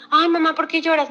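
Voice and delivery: a hint of a child asking mum; child's worried question. Child asking mum why she is crying, lightly voiced concern.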